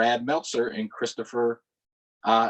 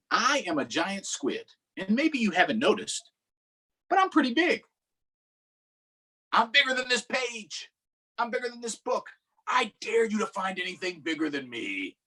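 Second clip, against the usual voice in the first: high, boastful giant squid; 10 semitones higher than usual. Acted. Squid boasting to the reader, high and proud. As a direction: brag like a huge sea creature. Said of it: "reading squid's lines in picture book".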